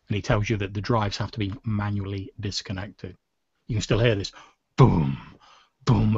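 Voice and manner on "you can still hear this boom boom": booming knocks. Acted. GM voicing pounding on door.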